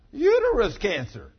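loud, high proclaiming; preaching shout. Real, not acted. Preacher to congregation, a loud high proclamation. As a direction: hit it hard like a verdict. Preacher hammers the consequence home.

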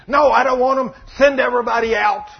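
high, loud acted tantrum; upset shout. Sick man dismisses the servants, upset and shouting. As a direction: shout the order in a hammed-up fit. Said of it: "He gets all upset".

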